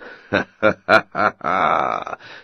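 loud, booming giant laughter; giant's laugh. A narrator's reading. The giant laughing at the tiny tailor, booming. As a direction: roar with laughter like an ogre. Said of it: "dal se do velikého smíchu".

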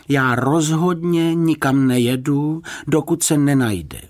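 high, slow, emphatic resolve; determined. A narrator's reading. Woman refusing to leave without him, determined. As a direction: dig your heels in, drawing it out. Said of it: "prohlásila odhodlaně".

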